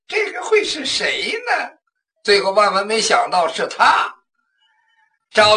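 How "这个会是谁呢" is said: slow, quiet, high wondering to himself; hushed, drawn-out private puzzling; puzzled inner thought. Acted. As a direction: muse under the breath, slowly. Zhao Bi wonders who it is.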